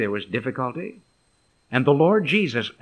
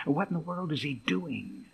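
hushed, puzzled murmur; 11 dB quieter than usual. Acted. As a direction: murmur it softly among onlookers. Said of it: "there was a murmuring just among them".

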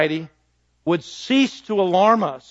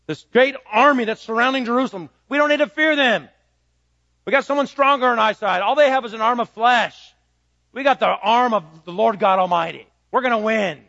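raised, higher preaching voice building confidence; rousing preaching; 5 semitones higher than usual. Acted. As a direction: preach it like a rallying cry to the church. Preacher rallying against fear.

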